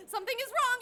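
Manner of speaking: ordinary talk, nothing marked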